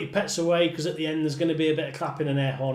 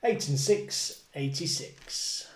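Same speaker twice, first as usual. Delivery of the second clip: bingo caller; 3 semitones higher than usual. Acted. Calling bingo numbers with rhymes.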